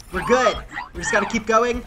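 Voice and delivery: usual speaking voice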